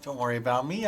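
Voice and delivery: ordinary talk, nothing marked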